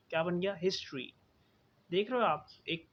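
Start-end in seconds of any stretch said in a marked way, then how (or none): 0.5-1.1 हिस्ट्री as his-chree; chr sound. Shown as a demonstration. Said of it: "चा और रा का साउंड". (हिस्ट्री में "च्र" की आवाज़: हिस-च्री)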